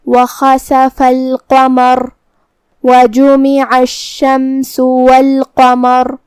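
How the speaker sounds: slow tajweed recitation with long-held vowels; Quran recitation. Shown as a demonstration. Teacher opens the lesson's verses, slow Quran recitation. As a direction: recite slowly and evenly, drawing out the syllables.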